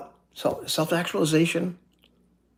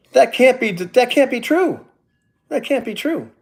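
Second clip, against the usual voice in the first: disbelief; 10 semitones higher, 3 dB louder than usual. Real, not acted. Super chat was off, disbelief.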